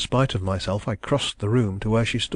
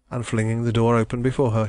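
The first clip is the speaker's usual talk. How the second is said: ordinary delivery, nothing marked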